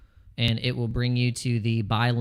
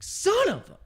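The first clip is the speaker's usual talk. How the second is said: high, strangled, cut-off curse; hammed-up annoyed muttering; mock curse. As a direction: bite off the curse in a squeaky voice. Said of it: "I was like".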